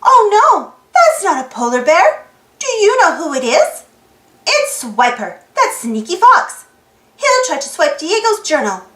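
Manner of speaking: plain reading voice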